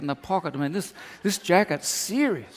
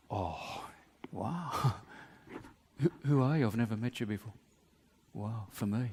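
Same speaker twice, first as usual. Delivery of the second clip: hushed, breathless wonder, lower; awed whisper; 5 semitones lower, 10 dB quieter than usual. Pretend. Man receiving an unexpected gift, whispering in awe. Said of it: "Or I can say".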